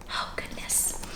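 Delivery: breathy whispered dismay. Real, not acted. Reader aside to listeners, whispered.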